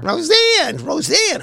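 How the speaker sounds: very high, whiny mock call; annoying Roseanne Barr voice. Host mimicking Roseanne's annoying voice, high grating impression. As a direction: screech the name in a grating, high voice. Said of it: "her voice was so annoying".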